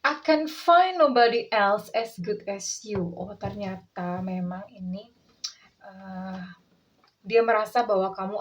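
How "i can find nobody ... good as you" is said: slow, drawn-out reading of the card; tender slow tarot reading in English; card message read out. Shown as a demonstration. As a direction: read the message slowly, savouring it. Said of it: "Apa yang dia pengen katakan".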